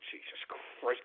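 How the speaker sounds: breathless whispered curse. Real, not acted. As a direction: rasp it out under breath. After a drink.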